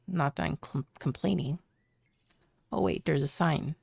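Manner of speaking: ordinary talk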